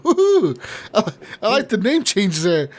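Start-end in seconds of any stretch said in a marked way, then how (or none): 0.1-0.5 high-pitched laughing "ooh"; amused whoop, much higher than normal speech; playful ooh. Real, not acted. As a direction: let out a high, giggly ooh. Playful reaction to silly name.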